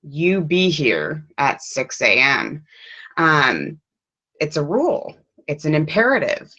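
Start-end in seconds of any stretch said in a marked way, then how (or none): 0.0-2.7 slow, flat, firm order with no rise; a mild stern boss voice, falling tone; bossy command. Shown as a demonstration. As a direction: lay it down like a boss giving a rule. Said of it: "The boss says".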